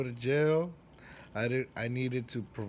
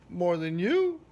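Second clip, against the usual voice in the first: louder, higher cheeky retort; cocky comeback; 6 semitones higher, 6 dB louder than usual. Retold or imitated. Answering a new student who doubts him, cocky. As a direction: toss it back with swagger.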